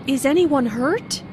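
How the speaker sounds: anxious yes-no question; worried. Acted. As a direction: ask with concern. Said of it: "asked in a worried way".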